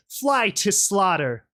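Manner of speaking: slow, high, drawn-out war cry; guttural shout. Acted. Rainbow Dash lunging at the rebel, shouting. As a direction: shout it above the commotion. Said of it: "guttural voice, rose above the commotion".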